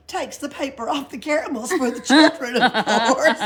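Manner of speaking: loud, high words breaking into laughter; laughing. Acted. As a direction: barely hold back the giggles. Pippi's joke, laughing.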